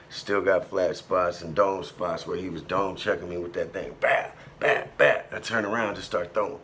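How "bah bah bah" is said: breathy punch sounds, mouthing the blows; mock hit sounds. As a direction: act out the wrench strikes with your mouth. Imitating wrench blows to head.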